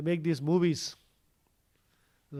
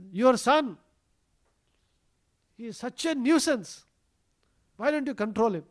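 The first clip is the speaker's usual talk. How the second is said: higher, louder mock scolding; angry complaint; 6 semitones higher, 6 dB louder than usual. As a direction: act out an indignant neighbour complaining to a mother. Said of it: "they would get very angry and they would go complain".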